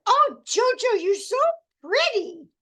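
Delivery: put-on high, loud little-girl gush; imitating little girl. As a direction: mimic a small child's adoring squeal. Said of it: "She says".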